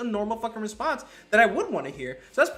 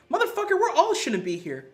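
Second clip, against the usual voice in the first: high, louder blunt comeback; mock retort; 10 semitones higher, 4 dB louder than usual. Speaker telling a Republican off, high and blunt. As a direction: fire it back at him, pitched up. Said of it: "And I'm like, looking at you".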